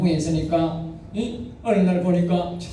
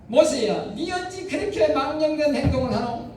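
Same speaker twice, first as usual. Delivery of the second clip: a hint of a scolding sister, pitched up; rebuking sister; 8 semitones higher than usual. Moses' sister lightly voiced rebuking him. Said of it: "그러니까 자기 누구가".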